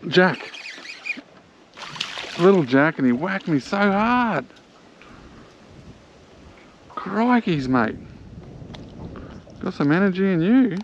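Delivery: high, thrilled chatter to the fish; buzzing over a feisty catch; excited. Real, not acted.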